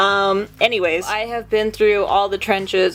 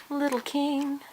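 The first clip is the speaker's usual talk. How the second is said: cooing to pet; 6 semitones higher, 10 dB quieter, slower than usual. Real, not acted. Doting owner to the munchkin on the scene, cooing softly. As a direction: coo it very quietly and high.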